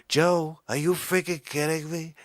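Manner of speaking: comic presidential impression, punchy and boastful; Trump. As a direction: put on a blustering politician voice. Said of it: "back and forth between the Trumper".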